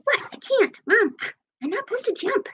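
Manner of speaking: small, high voice for a pet's excuse; voicing the pet. Owner answers as the pet asked to jump, high pet voice. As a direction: speak for the animal, high and soft. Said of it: "It's like".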